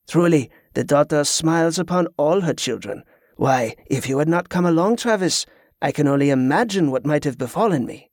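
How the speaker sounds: put-on lightly French-accented genial pirate. Pirate thanks Tezca for coming along, genial French accent. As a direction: speak as a pious French pirate.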